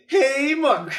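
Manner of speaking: high, loud, drawn-out greeting; intro shout. Shown as a demonstration. Host opening a gameplay video, high loud call. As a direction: belt out a long high "hey". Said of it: "was me just being like".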